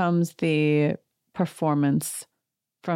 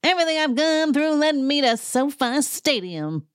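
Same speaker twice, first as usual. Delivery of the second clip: much higher dramatic mock Erica; 10 semitones higher than usual. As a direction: ham up the tortured diva. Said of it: "And she's like".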